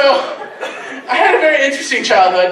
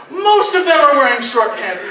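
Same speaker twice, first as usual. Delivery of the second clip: usual speaking voice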